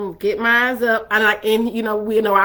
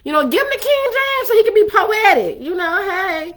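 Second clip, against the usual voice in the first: high, sing-song mock suggestion; 8 semitones higher than usual. Mocking a church friend's advice, high and teasing. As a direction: lift it way up, teasing.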